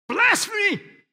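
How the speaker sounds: high, loud shocked shout of blasphemy; outraged cry. Retold or imitated. Preacher voicing the outraged crowd, loud shocked cry.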